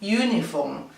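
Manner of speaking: "uniform" starting with a Y sound, not a Spanish U; English uniform. Shown as a demonstration. Teacher models "uniform" against Spanish U. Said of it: "no decimos el sonido U ... decimos uniform".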